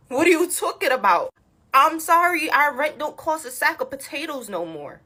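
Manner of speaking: ordinary talk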